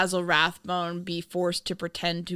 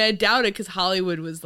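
ordinary talk, nothing marked

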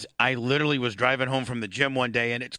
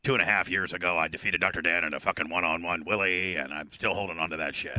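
low wrestler-style "brother" voice; gimmick heavy breather; 8 semitones lower than usual. Pretend. Caller insisting he always talks so, low gimmick voice. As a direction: keep it deep and heavy-breathed. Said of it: "like to heavy breathe".

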